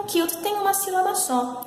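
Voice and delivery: ordinary talk, nothing marked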